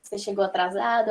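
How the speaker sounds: ordinary talk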